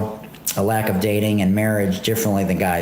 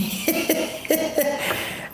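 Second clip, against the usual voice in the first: breathy laugh; 5 dB quieter than usual. Real, not acted. Laughing at own viral video.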